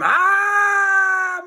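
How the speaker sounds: high shrieking cry; sudden high scream. Acted. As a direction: yelp as he gets eaten. Eaten by toilet.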